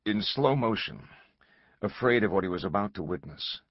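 usual speaking voice

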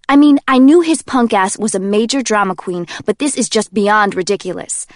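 loud, scornful rant; exasperated Kenji. Acted. Kenji vents to Jay about her boyfriend, loud and scornful. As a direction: roll your eyes and rant.

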